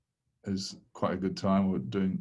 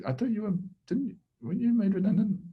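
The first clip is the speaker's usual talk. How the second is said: much higher, fast disbelieving squeal; astonished acquaintance; 13 semitones higher, much faster than usual. Retold or imitated. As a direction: mimic a stunned old colleague, high and quick. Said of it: "they go".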